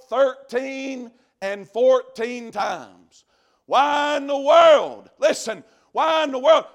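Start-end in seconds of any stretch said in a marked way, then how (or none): 3.7-6.6 preaching shout. Acted. Preacher demands why hell is removed, shouting. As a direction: cry it out to the whole church. Rhetorical cry in sermon.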